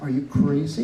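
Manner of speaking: loud incredulous Are you crazy, rising at the end; retold disbelief. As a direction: throw it back at him in disbelief. Retold outrage at condo plan.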